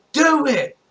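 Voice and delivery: high, sharp mock shout; short high-pitched challenge; acted dare. Retold woman daring her attacker.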